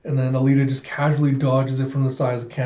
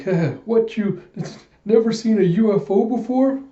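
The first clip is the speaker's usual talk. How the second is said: incredulous rustic voice; 8 semitones higher than usual. Acted. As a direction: scoff at him like a wide-eyed old prospector. Old prospector voiced in recap.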